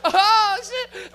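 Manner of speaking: high mock scream of alarm; acted panicked cry. Comic as frightened passenger, hammed-up panicked cry. Retold panic in swerving car.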